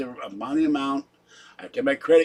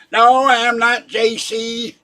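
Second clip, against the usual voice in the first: higher, louder, slower indignant denial; ten-star general persona; 8 semitones higher, 8 dB louder, slower than usual. Acted. Denying being J C in the general persona, loud mock outrage. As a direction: deny it with mock outrage, drawn out. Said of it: "you get into that persona".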